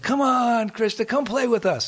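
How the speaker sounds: high, eager call of playmates; boys calling out. Acted. Friends calling Krishna away from his mother, high and eager.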